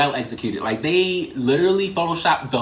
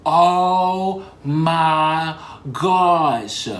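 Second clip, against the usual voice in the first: stunned; 4 dB louder, much slower than usual. Retold or imitated. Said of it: "And I'm just looking like".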